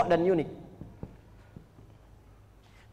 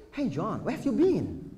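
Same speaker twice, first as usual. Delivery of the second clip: casual native English accent, lightly put on; 3 dB quieter, slower than usual. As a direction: greet a friend like a born English speaker. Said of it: "Bahasa Inggris kayak orang Inggris". (logat Inggris penutur asli, ringan)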